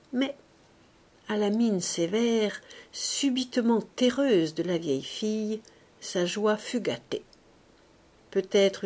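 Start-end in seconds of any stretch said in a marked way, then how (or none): none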